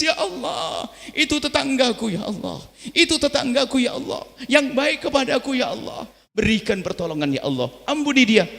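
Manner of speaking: weeping prayer. Acted. Pious man praying for his neighbour's daughter, weeping. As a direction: sob and plead for the neighbour. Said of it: "lalu nangis".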